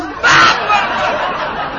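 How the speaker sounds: loud, high cry for help; sudden high-pitched holler; loud yell. Retold or imitated. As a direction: yell out as if being beaten. Yelling for help during beating.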